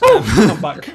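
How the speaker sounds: rough voice after choking; hoarse after choking. Real, not acted. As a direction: croak out after coughing. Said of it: "You're not dying, are you?".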